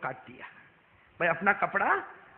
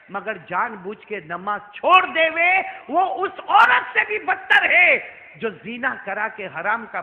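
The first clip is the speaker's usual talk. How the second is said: slow, loud, emphatic preaching; impassioned sermon; 2 semitones higher, 10 dB louder, slower than usual. Acted. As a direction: hammer each phrase home slowly. Preaching at full force.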